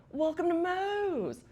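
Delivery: high, loud, long-drawn holler; imitating a child's shouted restaurant greeting; imitated kid's yell. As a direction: yell it like an eager kid, stretching the end. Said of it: "He, he yelled".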